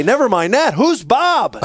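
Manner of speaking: father pretending outrage at his toddler's mistake; mock indignant. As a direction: play up the hurt father, half laughing. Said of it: "And I say".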